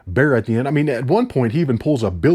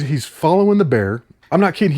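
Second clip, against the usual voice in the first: ordinary talk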